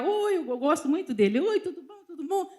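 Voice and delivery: high, soft, gushing hello; a giggly high-pitched hi to an old friend; sweet mock greeting. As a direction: greet him all sugary and light. Mimics greeting a friend at bar.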